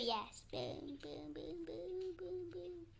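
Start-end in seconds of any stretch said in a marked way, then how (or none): none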